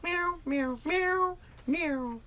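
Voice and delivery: high, squeaky play-cat meows; dad playing Grumpy Cat with high mewing. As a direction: meow up high like a little cat for the kids. Dad voices Grumpy Cat mask.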